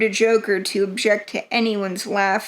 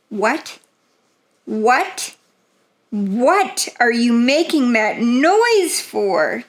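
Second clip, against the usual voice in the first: furious demand; slower than usual. A narrator's reading. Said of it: "demanded the king, turning furiously".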